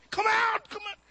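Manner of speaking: high, loud commanding yell. Retold or imitated. Preacher yelling at the possessed girl, loud command. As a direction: order it out with a yell. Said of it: "And really yelling".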